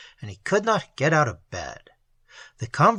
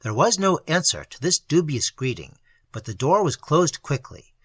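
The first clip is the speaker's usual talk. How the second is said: ordinary delivery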